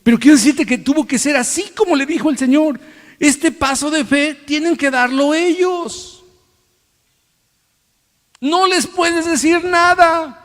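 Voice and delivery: high, slow, emphatic sermon voice over music; full preaching. Acted. Preacher telling the church the step of faith is theirs, rising preaching. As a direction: drive the lesson home slowly and intensely.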